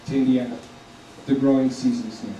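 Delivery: ordinary talk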